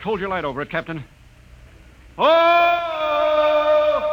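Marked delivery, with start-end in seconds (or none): 2.2-4.1 high, loud cry of surprise; jolted by a sudden sight; startled gasp. Acted. Hal looking down the shaft, crying out in surprise.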